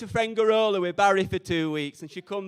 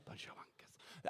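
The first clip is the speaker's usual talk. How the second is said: a sneering little whisper under the breath; whispered aside; 29 dB quieter than usual. Acted. Comic muttering a snide aside about fellow actors, whispered. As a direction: drop to a whisper for the insult.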